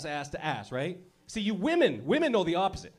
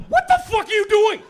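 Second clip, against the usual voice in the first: 1 freaked-out yell; 10 dB louder than usual. Retold or imitated. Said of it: "It's like".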